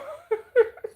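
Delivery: short, very high, loud giggle. Real, not acted. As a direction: burst into a squeaky laugh. Overwhelmed giggle after regrouping.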